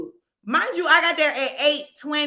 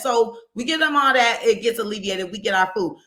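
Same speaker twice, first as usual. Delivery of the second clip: usual speaking voice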